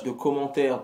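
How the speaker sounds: ordinary talk, nothing marked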